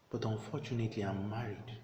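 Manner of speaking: softly spoken private admission; hushed confession. Acted. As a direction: confide it softly, as if thinking aloud. Acted soliloquy of a troubled priest.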